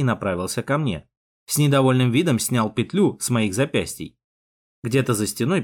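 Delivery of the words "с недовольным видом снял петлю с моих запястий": usual speaking voice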